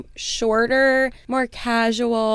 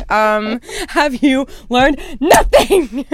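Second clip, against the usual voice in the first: slow, loud teasing reproach; mock scolding; 3 semitones higher, 6 dB louder, slower than usual. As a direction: scold her like a teacher. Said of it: "I was like".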